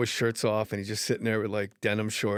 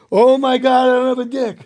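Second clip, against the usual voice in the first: much higher and louder panicked cry; 11 semitones higher, 15 dB louder than usual. Retold or imitated. Hypnotized guy in the bathroom, mock panic. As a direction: blurt it out in mock terror. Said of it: "the guy's crying panic".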